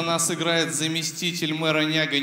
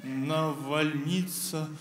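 slow, halting recital; 5 dB quieter, slower than usual. Acted. Pupil drags words.

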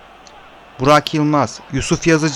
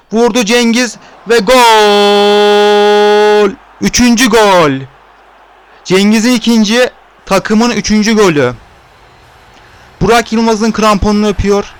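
screaming football commentator; 3 semitones higher, 4 dB louder than usual. Real, not acted. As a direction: hold the gol as long as you can. Goal, long stretched gol call.

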